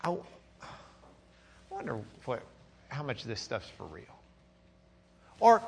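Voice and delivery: quiet, low voice of self-doubt; inner doubting voice. Acted. Preacher voices a believer's inner doubts, quiet self-doubt. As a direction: drop down soft and low, doubting yourself. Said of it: "this thing in your brain or in your heart that says to you".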